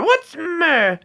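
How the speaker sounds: very high falsetto squawk; Python mother. Retold or imitated. Acting out the Brian manger scene, high falsetto. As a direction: play the suspicious mum at the door.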